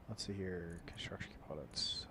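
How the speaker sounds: soft whispered mutter; whispering. Real, not acted. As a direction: whisper it to yourself. Muttering to himself while checking inventory.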